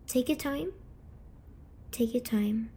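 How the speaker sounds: ordinary talk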